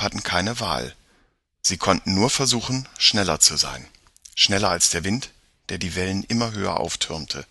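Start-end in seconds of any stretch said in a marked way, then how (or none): none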